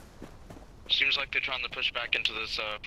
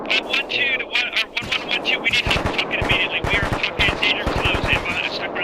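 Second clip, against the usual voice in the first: high, loud and fast panicked radio call; urgent call for help; 9 semitones higher, 5 dB louder than usual. Real, not acted. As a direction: shout it like you're pinned down. Pinned down, calling for help.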